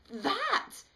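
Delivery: very high, loud terrified cry; panicked shout. A narrator's reading. Smitty shouting in panic at the rags. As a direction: blurt it out in horror.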